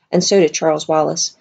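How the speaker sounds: plain reading voice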